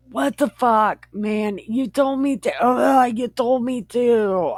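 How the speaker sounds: high-pitched mock protest; exasperated protest. Acted husband protesting to wife.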